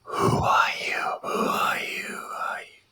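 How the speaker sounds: eerie ghostly whisper, fading away; haunting whisper. Acted. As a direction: breathe it like a lost spirit. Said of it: "these kind of whispered, almost haunting voices".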